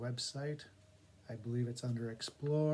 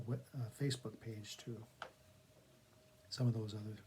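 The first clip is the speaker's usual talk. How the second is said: ordinary delivery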